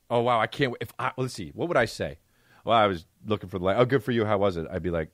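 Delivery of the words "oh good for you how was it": normal talking voice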